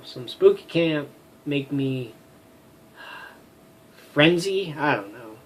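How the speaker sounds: usual speaking voice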